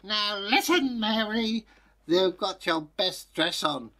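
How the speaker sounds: mock-strict mother, peaking on kill; stern mother. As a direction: ham up a shrill warning mum. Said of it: "And her mother said".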